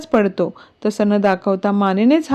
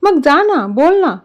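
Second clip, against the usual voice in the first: higher-pitched, teasing dare; amused teasing; 6 semitones higher than usual. A narrator's reading. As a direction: egg him on, amused, without laughing. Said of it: "लॅपटॉपकडे बघत हसत बोलतो". (चिडवत, चढ्या सुरात)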